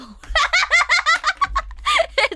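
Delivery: high, loud burst of laughter; shrieking laugh. Real, not acted. Streamer cracking up after calling someone an old prick, shrieking laughter. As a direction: explode into a shrieking cackle.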